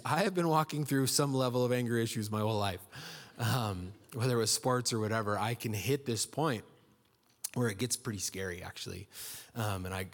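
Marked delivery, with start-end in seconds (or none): none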